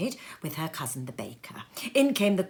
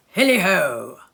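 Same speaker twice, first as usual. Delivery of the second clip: jovial cry; 7 dB louder than usual. A narrator's reading. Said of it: "cried old Fezziwig".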